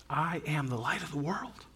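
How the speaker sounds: soft, understated declaration; hushed claim of identity; quiet bold claim. Retold or imitated. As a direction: say it very softly but with certainty. Quietly voices Jesus' audacious claim.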